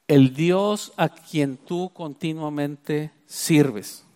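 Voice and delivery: every word drawn out, weight on "continuamente"; slow emphatic quote. Shown as a demonstration. Preacher dwells on the king's words, slow and emphatic. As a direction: lean slowly on "el Dios a quien tú continuamente sirves". Preacher stresses the key phrase.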